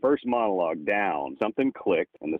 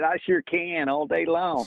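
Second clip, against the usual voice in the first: slow, higher East Tennessee drawl, proudly showing it off; East Tennessee accent; 9 semitones higher, slower than usual. Retold or imitated. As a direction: lay the East Tennessee accent on thick and unhurried. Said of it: "Can you do an East Tennessee accent?".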